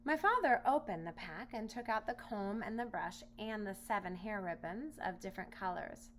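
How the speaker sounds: plain reading voice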